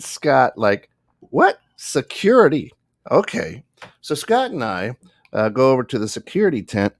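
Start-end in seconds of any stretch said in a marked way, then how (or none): 1.2-3.6 high, incredulous "What?" then a baffled okay; stunned disbelief. Retold or imitated. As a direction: sound floored by the news. Told they are now security.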